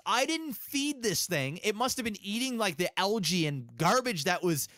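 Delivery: ordinary delivery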